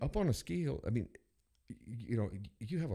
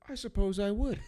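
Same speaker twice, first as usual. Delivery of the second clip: amused reply; 10 semitones higher than usual. Retold or imitated. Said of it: "I said".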